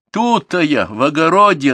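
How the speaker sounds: high loud answering call; grandma calls back from the garden. A narrator's reading. Grandmother answering from the vegetable patch, loud call. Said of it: "отзвалась бабушка".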